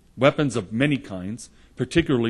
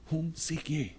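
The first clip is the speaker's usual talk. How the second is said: higher, much softer Jesus voice; Jesus asking again; 6 semitones higher, 8 dB quieter, slower than usual. Acted. Jesus asking the crowd a second time, hushed. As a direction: ask it hushed and gentle.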